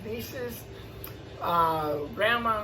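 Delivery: ordinary delivery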